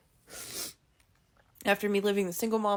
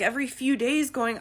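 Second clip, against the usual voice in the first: ordinary delivery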